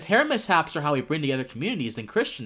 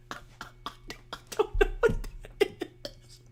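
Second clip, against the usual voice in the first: high, breathy words squeezed out through laughter; breathless laughing; 6 dB quieter, slower than usual. Real, not acted. Host to cohosts, cracking up over the glue, laughing. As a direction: talk through a laughing fit you can't stop.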